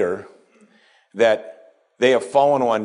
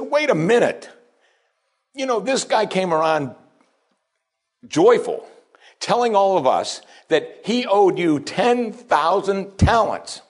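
indignant protest; 7 semitones higher than usual. Acted. Fellow slaves protest to the Lord.

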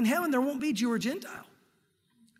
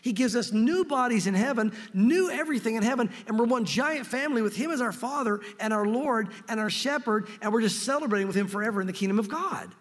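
ordinary talk, nothing marked

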